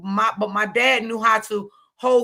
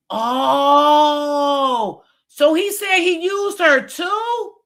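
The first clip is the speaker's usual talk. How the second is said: higher and slower, aghast; shocked cry; 5 semitones higher, 4 dB louder, much slower than usual. Real, not acted. Vlogger learning he used the lady too, shocked and higher. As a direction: gasp out the question in shock.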